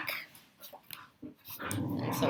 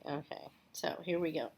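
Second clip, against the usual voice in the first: plain reading voice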